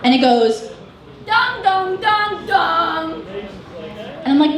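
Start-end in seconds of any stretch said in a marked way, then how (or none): 1.3-3.2 sung, tolling bell notification; imitated phone chime. Mom's phone going off, chime imitation. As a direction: intone it like slow bell strikes. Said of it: "my mom's phone goes off".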